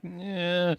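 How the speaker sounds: soft, doubtful noise; reluctant "eh". Retold or imitated. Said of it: "I'm like".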